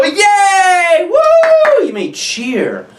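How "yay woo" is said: loud, very high sports-crowd whoop; cheering shout. Acted. Fan at a big sports game, shouting. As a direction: cheer as if someone just scored. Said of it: "they may shout and go".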